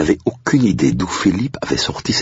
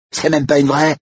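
put-on high-pitched kid scoffing; high, thin-skinned bravado; boy crying out; 14 semitones higher, 3 dB louder than usual. A narrator's reading. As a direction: exclaim it like a defiant child. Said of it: "s'écria l'autre copain de Yanis".

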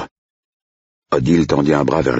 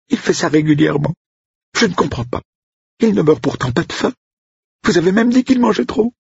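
upset cook. Acted. Cook complaining to Blake about the cat, high upset voice.

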